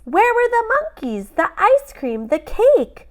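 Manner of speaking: put-on high whiny kid voice; disappointed child. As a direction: plead where the fun went.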